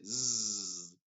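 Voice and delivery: slow, long buzzing zzz; drawn-out voiced zzz; buzzing English z. Shown as a demonstration. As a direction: let the zzz vibrate like an S with voice. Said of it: "Se dice".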